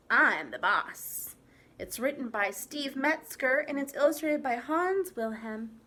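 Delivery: ordinary delivery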